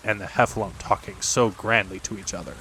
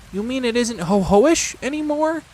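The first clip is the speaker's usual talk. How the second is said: hopeful; 13 semitones higher, 3 dB louder than usual. A narrator's reading. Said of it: "said Piglet hopefully".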